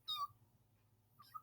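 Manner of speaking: tiny, very high meow; soft, squeaky kitty cry; cat meowing. A narrator's reading. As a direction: mew faintly like a kitten. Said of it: "But who says".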